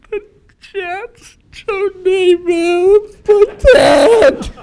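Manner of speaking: high, loud, wailing blubber of the team names; hammed-up crying, voice cracking way up; mock sobbing. Answering his worried wife, in a loud, high, hammed-up sob. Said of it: "she sees me crying".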